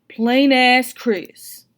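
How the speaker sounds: very slow, drawn-out teasing name. A narrator's reading. Father needling the boyfriend, slow teasing. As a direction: savor the nickname slowly.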